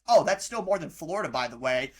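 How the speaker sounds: usual speaking voice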